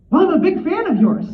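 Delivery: high, loud, eager blurt; acting out a hasty declaration of fandom; flustered fan. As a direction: placate a scowling idol with loud praise. Retells answering the scowling author.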